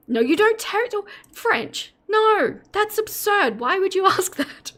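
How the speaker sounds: high-pitched, incredulous protest breaking into laughter; amused, squeaky disbelief; mock outrage. Real, not acted. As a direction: scoff at an absurd question, voice way up. Mock outrage at absurd chat question.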